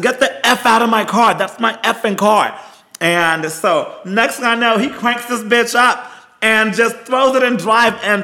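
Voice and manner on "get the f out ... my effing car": high, raised-voice order to get out; retold angry yell. Running at the man stealing her car, retold angry yell. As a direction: re-enact yelling at a thief.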